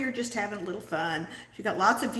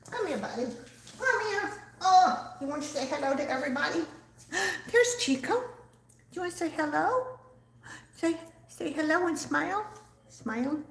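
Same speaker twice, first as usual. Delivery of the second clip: much higher, coaxing voice for the little dog; 6 semitones higher than usual. Real, not acted. Owner coaxing her chihuahua Chico to greet viewers, high baby talk. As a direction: sweet-talk the chihuahua into saying hello.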